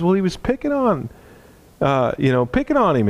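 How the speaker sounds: put-on high, earnest boy explaining himself; pleading boy. Boy explaining to his dad why he grabbed the bully, high earnest voice. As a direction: defend yourself to dad as a kid.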